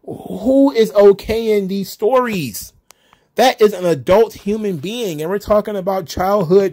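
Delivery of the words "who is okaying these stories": high, slow, incredulous outburst; mock disbelief. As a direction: act out your own shocked disbelief, stretched and higher. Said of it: "And I just was like".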